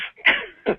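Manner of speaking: high-pitched laugh; laughing at his own punchline. Real, not acted. Speaker cracks up after telling the joke, high laugh.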